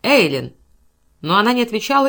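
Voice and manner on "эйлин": short, falling call; hoarse call. A narrator's reading. Cowperwood calls Aileen once more, hoarse call. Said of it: "хрипло позвал Каупервуд".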